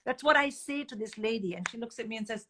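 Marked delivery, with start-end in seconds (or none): none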